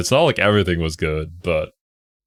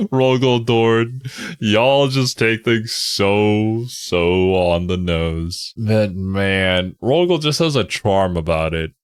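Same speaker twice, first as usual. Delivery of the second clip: ordinary delivery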